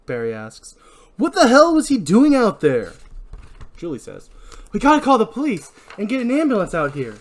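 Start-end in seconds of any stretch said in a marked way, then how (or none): 1.2-2.9 loud, high, outraged question; heated outburst; angry demand. Acted. Barry angry about the victim. 4.7-7.2 high, loud, urgent plea; agitated, high-pitched urging; urgent alarm. Acted. Julie urges calling police.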